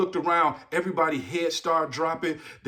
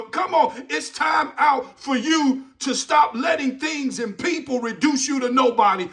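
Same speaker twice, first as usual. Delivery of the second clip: preaching at full force; 4 semitones higher than usual. Acted. Motivational speaker rousing students with a shouted sermon build.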